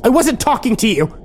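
high, sharp yell; angry yell. A narrator's reading. Kimber shutting down her friend, yelling. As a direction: cut them off with a high yell. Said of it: "Kimber yelled at me".

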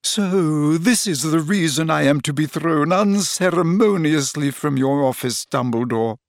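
high, theatrical seer voice; dramatic Trelawney. A narrator's reading. Trelawney accusing Dumbledore over Harry, theatrical. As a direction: play the wronged prophetess grandly. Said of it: "pointing dramatically at Harry".

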